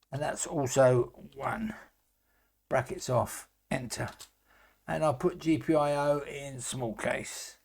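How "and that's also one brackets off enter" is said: whispered aside; whispering. Real, not acted. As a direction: breathe it under your voice. Unvoiced aside while coding.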